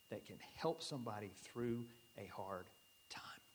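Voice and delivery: soft whisper, fading away. Real, not acted. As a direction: trail off in a whisper. Whispered end of sermon thought.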